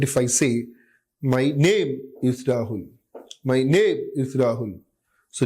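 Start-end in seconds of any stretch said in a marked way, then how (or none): 1.2-2.9 explosive undue stress on "name"; staccato speech. Shown as a demonstration. Lecturer demonstrating staccato speech to students, "name" explosively stressed. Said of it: "undue stress on a certain syllable". 3.4-4.8 "name" hit with explosive stress; staccato speech. Shown as a demonstration. Lecturer repeating a patient's staccato speech, "name" over-stressed. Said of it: "undue stress on a certain syllable".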